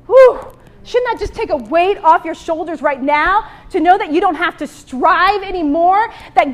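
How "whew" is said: exhaled whoop of delight; sermon high point whew; loud high whew. Real, not acted. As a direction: blow out a loud excited "whew". Preacher's exclamation after point.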